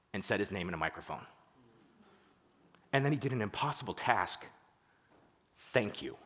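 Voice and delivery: hushed, low, imitating moved listeners; retold tearful gratitude. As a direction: retell it softly with long, heartfelt pauses. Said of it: "tears streaming down their face".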